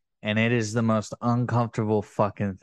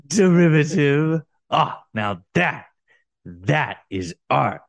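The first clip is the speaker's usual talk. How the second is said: slow, drawn-out art critic verdict; Ongo Goblosian; slower than usual. Acted. Mocking an art snob judging the work, slow art critic act. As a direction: pronounce judgement like a self-important gallery visitor. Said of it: "Ongo Goblosian".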